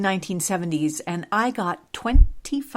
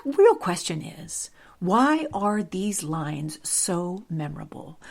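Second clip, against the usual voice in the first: usual speaking voice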